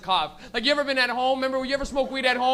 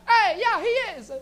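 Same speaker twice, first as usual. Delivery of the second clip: high, loud nonna chiming in; Italian nonna; 10 semitones higher, 5 dB louder, slower than usual. Acted. Comic as nonna in the back, high proud holler. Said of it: "His nonna's in the back".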